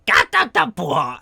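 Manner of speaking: high, loud stuttering squawks dropping at the end; startled creature gibberish. Acted. Game master as the Skidamander falling, startled gibberish. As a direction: babble like a startled little creature.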